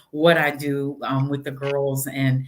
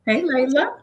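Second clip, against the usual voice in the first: bright greeting; 11 semitones higher, 4 dB louder than usual. Real, not acted. Greeting a viewer on air.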